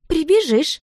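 high, loud, desperate shout; desperate cry. A narrator's reading. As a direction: act her crying out in desperation. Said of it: "отчаянно крикнула она". (отчаянный высокий крик)

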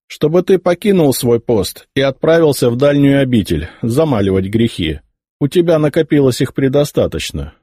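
lightly voiced scornful heir; arrogant young emperor. A narrator's reading. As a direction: order the old bishop away with disdain. Nephew voiced, contemptuous.

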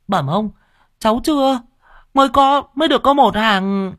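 put-on very high, small child's voice; timid boy. Thieving boy answering the official, high and timid. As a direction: answer up high like a scared kid.

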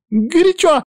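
very high, loud squeal. A narrator's reading. Witch stepping into too-hot water, squealing. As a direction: yelp it high, as if scalded. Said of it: "взвизгнула".

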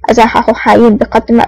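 plain reading voice

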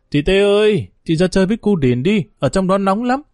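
put-on a boy calling his sister to come play; little boy calling. A narrator's reading.

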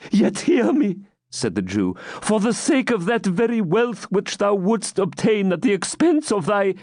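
put-on high, beseeching old man; pleading old man. Captive pleading for his friends, beseeching. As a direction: beg for your companions.

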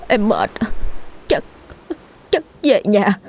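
weak, breathless broken words; running out of air. A narrator's reading. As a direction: gasp it out, exhausted and scared. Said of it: "Thằng Long quay sang Hậu thều thào".

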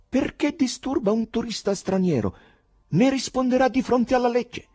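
high, loud indignant character voice; scolding choirmaster. A narrator's reading. Choirmaster scolds and threatens with law.

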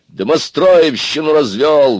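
high, loud, drawn-out accusation; exclaiming. A narrator's reading. Лысый piles on Аристарх, exclaiming. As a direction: exclaim it indignantly. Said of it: "воскликнул Лысый".

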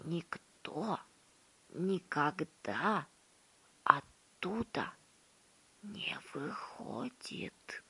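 hushed secretive whisper. A narrator's reading. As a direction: lean in and whisper it. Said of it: "понизив голос до шёпота".

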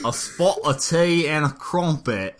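laughing, badly faked British accent. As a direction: ham up a Brit, drifting Australian. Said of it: "that sounds more Australian".

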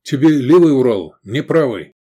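loud battle order; command. A narrator's reading. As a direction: order it crisply over the noise. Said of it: "скомандовал".

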